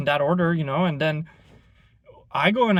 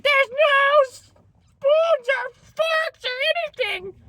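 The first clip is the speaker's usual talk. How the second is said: much higher and slow, drawn-out whine; mock Karen voice; 3 dB louder, much slower than usual. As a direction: stretch out the complaint like a spoiled customer. Karen complaining at sauce table.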